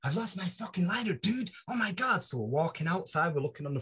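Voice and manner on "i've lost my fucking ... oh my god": higher-pitched mock panic; panicked breakdown. Morgan tells his hosts about his lost lighter, mock panic. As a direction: imitate someone distraught over a loss. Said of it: "having a mental break, literally a mental breakdown".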